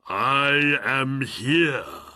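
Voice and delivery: slow, loud, grand genie voice; booming genie. Acted. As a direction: announce yourself like a mighty spirit. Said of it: "the genie cried".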